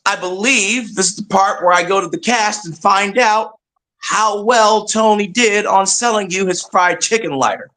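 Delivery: ordinary delivery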